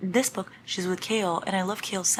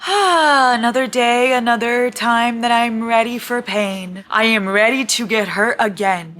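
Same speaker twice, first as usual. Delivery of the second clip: loud, higher, slow martyr declaration; dramatic resignation; 6 semitones higher, 9 dB louder than usual. Real, not acted. Reader declaring herself ready for pain, loud and slow. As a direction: proclaim it like a weary martyr.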